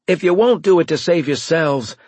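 loud, high, urgent appeal; desperate plea. A narrator's reading. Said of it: "feeling desperate".

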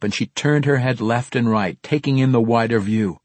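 ordinary delivery, nothing marked

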